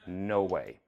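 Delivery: normal talking voice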